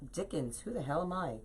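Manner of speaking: ordinary talk, nothing marked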